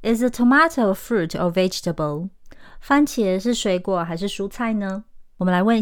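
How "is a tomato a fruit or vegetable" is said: British question with to-MAH-to for "tomato"; British pronunciation. Shown as a demonstration. Teacher reading an example question, British accent. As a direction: say "tomato" with a long ah, British style. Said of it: "例句".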